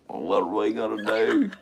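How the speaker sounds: low, drawled Southern lament; Bill Clinton. Acted. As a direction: sink down deep on the question. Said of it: "You gotta have Bill Clinton".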